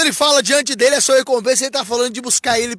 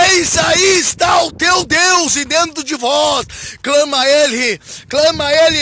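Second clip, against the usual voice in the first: raised, forceful preaching cry; pregação exaltada; 3 semitones higher than usual. Real, not acted. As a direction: call the congregation out with full conviction. Clímax da exortação ao povo. (clamor de pregador)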